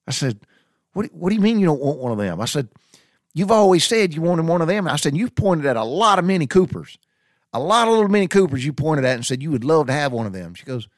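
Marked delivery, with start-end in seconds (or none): 0.9-10.5 high, loud, disbelieving protest; incredulous raised voice. Retold or imitated. Telling wife she always wanted one, loud and incredulous. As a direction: push back hard, pitch climbing. Arguing with wife over car.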